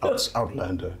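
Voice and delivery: hint of a solemn warrior; Gnias. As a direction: address the outsider coldly. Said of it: "Gnias looks at him and is like".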